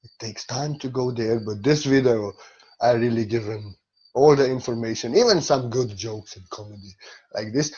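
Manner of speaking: ordinary talk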